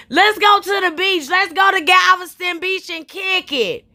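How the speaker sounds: a lightly voiced drunk girl pleading for the beach; drunk friend's whoop. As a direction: imitate the friend begging to hit the beach.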